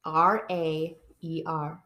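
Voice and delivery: slow, letter by letter; spelling it out. Shown as a demonstration. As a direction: spell it slowly, spacing each letter. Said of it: "Spell it for me".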